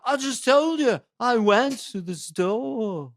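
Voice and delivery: annoyed Liverpool drawl; Liverpool accent. Shown as a demonstration. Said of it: "key to doing a Beatle accent".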